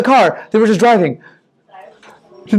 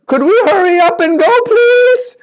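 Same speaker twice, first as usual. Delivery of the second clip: put-on high, pleading boy's voice rising higher; nervous servant boy; 11 semitones higher, 3 dB louder than usual. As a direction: beg to hurry, voice climbing up. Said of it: "the servant boy ... said".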